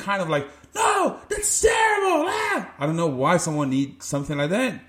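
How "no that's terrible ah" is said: very high, slow exaggerated shout; mock coach tirade. Showing the yelling some players need, high mock shout. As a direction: wail it out in hammed-up outrage.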